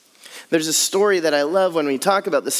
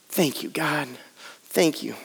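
soft thanks; 5 semitones lower, 6 dB quieter than usual. Pretend.

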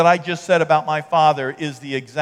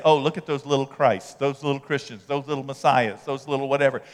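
mocking taunt; 6 semitones lower, 6 dB quieter than usual. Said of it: "they began to say".

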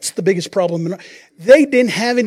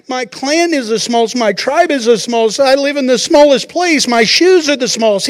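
higher, louder flustered protest; panicky Gideon; 6 semitones higher, 5 dB louder than usual. Acted. Frightened Gideon protesting to the angel, high and flustered. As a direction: sputter out excuses in alarm.

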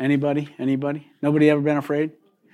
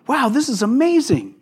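higher-pitched wowed reaction; amazed crowd; 8 semitones higher than usual. Retold or imitated. Preacher voices the crowd hearing Jesus, amazed.

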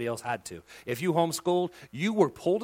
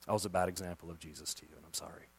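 humble apology; 9 semitones lower, 10 dB quieter, faster than usual. Pretend. Continuing mock apology.

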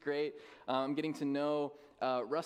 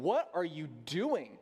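slow, exasperated mock scolding; irritated; slower than usual. Wife to sleeping husband at night, mock irritated. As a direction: imitate an irritated wife, drawing it out. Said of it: "my wife really irritated".

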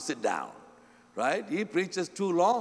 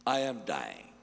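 low, soft deathbed words; dying man; 10 semitones lower, 6 dB quieter than usual. Acted. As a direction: sink down quietly like a man dying. Acting out a deathbed farewell.

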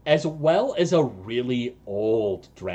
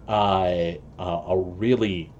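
ordinary delivery

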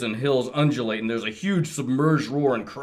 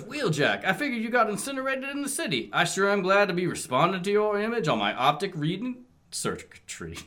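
high, rushed cartoon robot voice; Blaster voice; 7 semitones higher than usual. First read of Blaster's greeting to Wheeljack, high and rushed. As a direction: race through it, pitched way up. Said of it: "You gotta do a Jersey accent".

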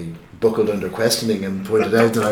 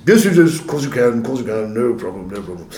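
eager priest; 5 dB louder than usual. Retold or imitated. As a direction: reassure him too eagerly, then trail off. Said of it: "He said".

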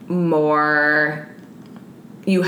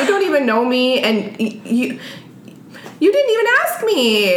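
much higher, fast, exasperated protest; indignant disbelief; 9 semitones higher than usual. Retold or imitated. Ranting about the man who got angry, high and fast. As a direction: rise up high in offended disbelief.

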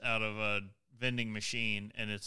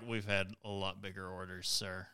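lower, softer, unimpressed reply; unfazed clerk; 4 semitones lower, 7 dB quieter than usual. Retold or imitated. Store staff to a customer with a huge order, unfazed. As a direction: imitate a clerk who doesn't even blink. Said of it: "they didn't even blink".

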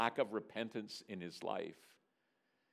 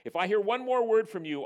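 loud, high-pitched parental threat; mock roar; 9 semitones higher, 8 dB louder than usual. Father threatening daughter from outside bedroom, mock roar. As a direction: roar a warning as a fed-up parent. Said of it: "I roared".

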